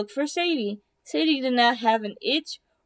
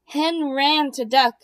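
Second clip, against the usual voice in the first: usual speaking voice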